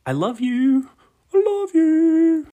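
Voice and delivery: very high, loud squeal of I love you; climbing into a loud falsetto squeal; squeaky sign-off. Pretend. Playful sign-off, rising to squeal.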